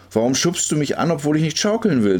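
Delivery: indignant protest. A narrator's reading. Girl asks why he pushes her, protesting. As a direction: raise the voice in protest at him.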